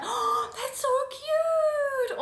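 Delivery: squealed, very high and slow, over the top; gushing excitement. Retold or imitated. Friend picking up a pretty box, squealing delight. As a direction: gush like you just found a pretty box. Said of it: "be like".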